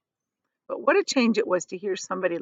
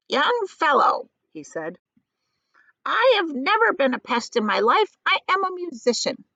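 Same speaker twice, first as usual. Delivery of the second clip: put-on indignant old grasshopper; 8 semitones higher, 3 dB louder than usual. A narrator's reading. Old grasshopper indignant, withering look.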